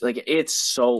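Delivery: ordinary delivery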